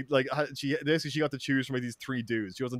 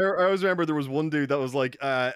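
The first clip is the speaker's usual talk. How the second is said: normal talking voice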